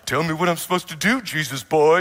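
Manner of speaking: a touch of sarcastic mockery; mocking. How the stranger heard him, lightly mocking. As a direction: tease him lightly as if scoffing. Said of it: "like, mocking him".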